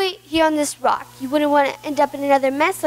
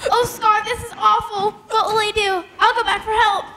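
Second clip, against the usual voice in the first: higher, breathless distress; panicked; 6 semitones higher than usual. Acted. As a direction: cry out in frantic worry. Panicked plea.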